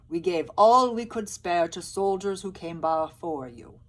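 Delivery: loud, insistent peasant; Albert turning the soldiers away; Albert excusing. Acted. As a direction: insist there is nothing left. Albert refusing.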